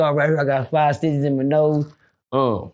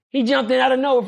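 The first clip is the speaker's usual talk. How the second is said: ordinary delivery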